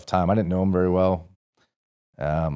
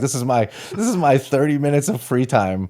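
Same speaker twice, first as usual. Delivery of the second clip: gleeful relief; 7 semitones higher, 5 dB louder than usual. Retold or imitated. Recruit relishing rare private time.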